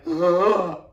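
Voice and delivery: loud, high mock gasp; shocked child's cry. Scolded kindergartner reacting to his teacher, loud mock cry. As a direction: blurt a wordless startled noise. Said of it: "And I was like".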